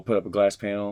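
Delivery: ordinary delivery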